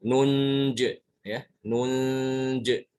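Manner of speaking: nunj with long nasal hum, bounced j; stopped without ya. Shown as a demonstration. Teacher showing the pause form, hummed and bounced. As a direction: hold the n of nunj, no i. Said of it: "Sehingga ketika berhenti".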